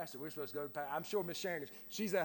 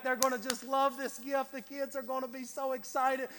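higher-pitched, mock excited hopefulness; eager anticipation; 7 semitones higher, 4 dB louder than usual. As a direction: act out someone sure of a hit. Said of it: "you get this expectation".

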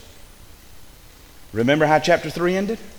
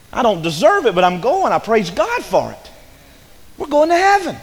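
high, loud pulpit cry; exultant preaching; 8 semitones higher, 5 dB louder than usual. Real, not acted. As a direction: proclaim it jubilantly to the congregation. Preaches at full force about heaven.

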